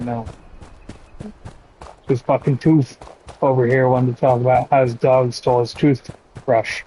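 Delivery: usual speaking voice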